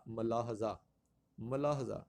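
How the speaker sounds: ملاحظہ with short a, ma-lahza; correct pronunciation. Shown as a demonstration. Teacher gives the right option, careful pronunciation. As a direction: open ملاحظہ with ma-. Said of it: "اس کو ہم ملاحظہ کہتے ہیں".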